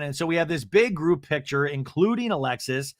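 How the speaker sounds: ordinary talk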